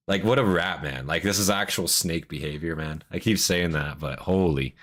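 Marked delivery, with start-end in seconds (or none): none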